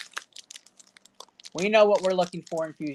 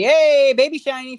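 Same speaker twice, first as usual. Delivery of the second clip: louder, much higher delighted hey; excited greeting; 7 semitones higher, 10 dB louder than usual. Real, not acted. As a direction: greet the card with real delight. Excited at pulling a shiny card.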